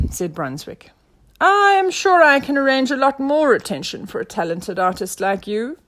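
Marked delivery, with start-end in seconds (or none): 1.4-5.8 loud, raised proclamation; detective promising to help; generous inspector. A narrator's reading. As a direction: announce a grand offer. Inspector makes a generous offer.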